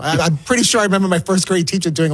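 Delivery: ordinary talk, nothing marked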